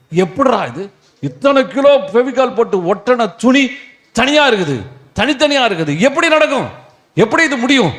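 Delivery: high-pitched, incredulous outburst; amazed disbelief. Acted. Preacher voices an onlooker stunned by the loose cloths, amazed. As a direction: marvel at the impossible, pitch climbing.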